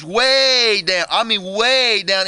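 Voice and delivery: WAY stretched out long; slow, elongated "way" each time; stress on WAY. A narrator's reading. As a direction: drag out the word "way" to show depth. Stressing how far he reaches.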